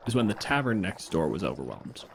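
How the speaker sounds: ordinary delivery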